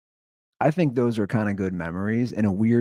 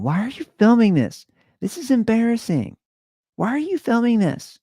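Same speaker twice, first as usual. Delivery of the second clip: mock exasperated wife, much higher and louder; imitating his wife; 13 semitones higher, 4 dB louder than usual. Husband mimicking his wife scolding him for filming, high-pitched mock whine. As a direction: pitch it way up like a nagging complaint. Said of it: "just keeps going".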